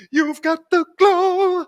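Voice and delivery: high, loud, slow words after a laugh; real laughter, then high and slow. Real, not acted. Laughing reply.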